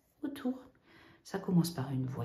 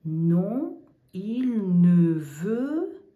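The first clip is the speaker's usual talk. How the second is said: slow emphatic negation; 2 semitones higher, 8 dB louder, much slower than usual. Shown as a demonstration. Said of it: "Il veut tout prendre".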